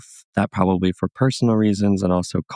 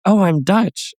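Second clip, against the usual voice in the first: eager claim; 12 semitones higher, slower than usual. Retold or imitated. Proudly telling Dutch woman her heritage.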